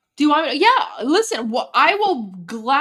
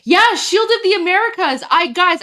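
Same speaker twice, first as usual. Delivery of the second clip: excited; 6 semitones higher, 8 dB louder than usual. Real, not acted. Excited about the speech.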